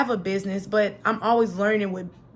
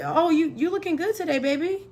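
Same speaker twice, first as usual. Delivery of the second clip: flirty compliment; 6 semitones higher than usual. Pretend. Voicing flirty compliment to fellas.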